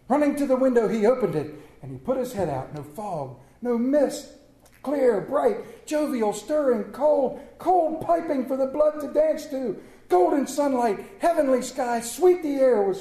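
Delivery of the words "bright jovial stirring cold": normal talking voice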